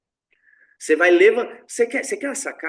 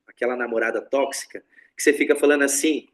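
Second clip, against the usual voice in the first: normal talking voice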